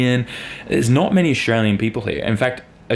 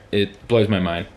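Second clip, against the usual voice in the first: normal talking voice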